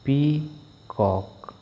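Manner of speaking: slow, split into syllables pee-cock; drawn-out teacher demo of peacock. Shown as a demonstration. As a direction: stretch peacock out for clapping each syllable. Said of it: "for each syllable".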